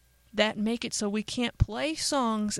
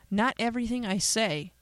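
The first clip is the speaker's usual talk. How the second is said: usual speaking voice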